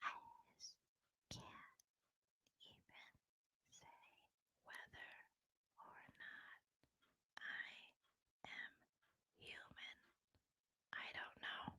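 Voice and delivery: barely audible, word-by-word whisper; mocking an ultra-slow, faint whisperer. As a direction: whisper with long gaps between words. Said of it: "Lauren's is like".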